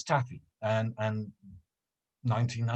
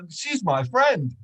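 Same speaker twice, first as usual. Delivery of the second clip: higher, slower wounded tone; storyteller jokes his friend called him old, mock hurt; 8 semitones higher, 6 dB louder than usual. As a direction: pretend to be offended.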